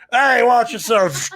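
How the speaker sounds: high, loud acted call; shouted warning. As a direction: yell at a clumsy boy to take care. Acted warning as boy knocks things over.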